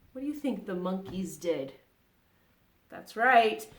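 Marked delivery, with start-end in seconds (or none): none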